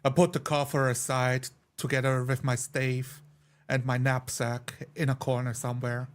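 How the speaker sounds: usual speaking voice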